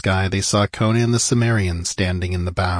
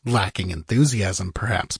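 usual speaking voice

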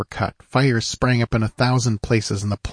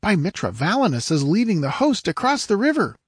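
higher-pitched squire reporting a battle; raised, urgent squire's voice; excited squire; 9 semitones higher than usual. A narrator's reading. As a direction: call the action as an eager young attendant.

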